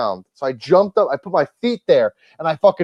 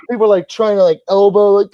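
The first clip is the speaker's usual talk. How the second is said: ordinary talk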